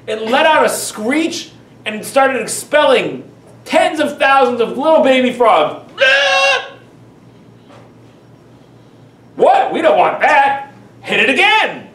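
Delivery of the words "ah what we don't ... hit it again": high, loud shocked cry that settles into an order to hit; outraged Egyptians. Acted. Preacher voicing Egyptians beating the frog, panicked high yell.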